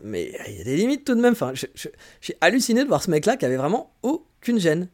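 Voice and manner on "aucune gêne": "aucune" split by a pause after its first syllable; astonished emphasis. Real, not acted. Vlogger amazed by a shameless guest, "aucune" emphatically broken in two. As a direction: break "aucune" in two for effect. Stressing his total lack of shame.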